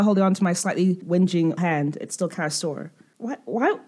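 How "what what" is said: usual speaking voice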